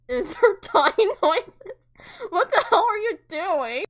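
real laughing yell; laughing outcry. Real, not acted. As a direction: yell while cracking up. Laughing through game chaos.

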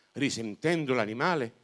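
ordinary delivery

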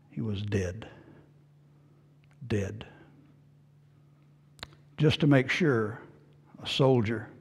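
low, very soft, long pauses; hushed solemn preaching. Real, not acted. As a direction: let each phrase fall into silence.

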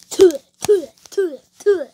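sharp, loud "tuh" spitting noises; mock spitting. Godzilla spitting on his host, loud mock spits. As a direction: spit out each "tuh" in a quick burst. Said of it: "I spit on you like".

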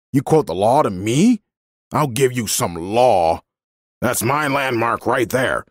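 angry man. A narrator's reading. Landowner arguing back at a woman, angry. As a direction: defend your land with rising temper. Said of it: "he yelled".

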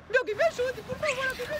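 súplica baixinha. Acted. Bruxa sozinha pede ajuda.

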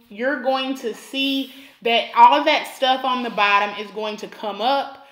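ordinary talk, nothing marked

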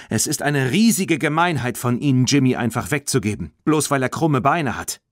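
high, indignant accusation; lively, outraged young heroine. A narrator's reading. Girl indignantly accuses the man.

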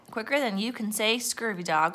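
plain reading voice

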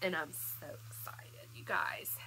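breathless hushed whisper, barely voiced; excited whisper. Real, not acted. Excited whisper before tasting.

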